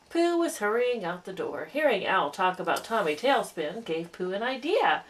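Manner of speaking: ordinary delivery, nothing marked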